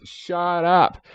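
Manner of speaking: high-pitched "shut up" squawk; mock outburst. Cutting across his own chart talk, mock outburst. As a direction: blurt it high, in jest.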